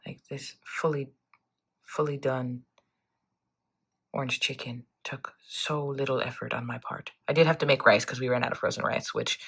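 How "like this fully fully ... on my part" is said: hushed whisper. Real, not acted. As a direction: whisper it softly. Whispered unvoiced lines.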